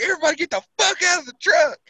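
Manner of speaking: very high, fast, loud shout; retold yell. Driver yelling at everyone to leave the smoking truck, high and fast. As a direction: bark the order to bail out. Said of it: "I'm like".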